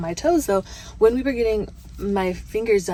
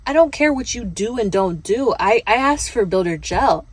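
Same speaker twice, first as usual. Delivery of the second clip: indignant inner rant; 3 semitones higher, 7 dB louder than usual. Retold or imitated. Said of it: "in my mind, I'm thinking".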